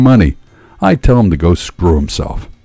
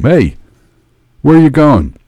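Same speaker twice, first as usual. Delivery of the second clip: raised, calling voice; shouted out; 6 semitones higher, 4 dB louder than usual. A narrator's reading. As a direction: call out to someone outside the car. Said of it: "I shouted out to him".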